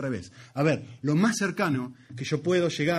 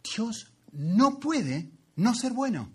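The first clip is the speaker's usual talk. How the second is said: slow, higher, stressing each "no"; emphatic slow statement; 8 semitones higher, much slower than usual. Shown as a demonstration. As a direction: lay weight on "no puede no ser bueno" slowly. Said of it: "al revés".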